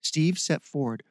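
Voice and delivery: ordinary delivery, nothing marked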